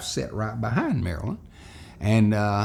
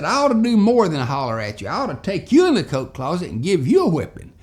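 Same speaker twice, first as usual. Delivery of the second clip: loud, fast, furious threat; angry teacher threat; 7 semitones higher, 7 dB louder, faster than usual. Retold or imitated. Miss Hortense threatening Marilyn in loud, fast anger.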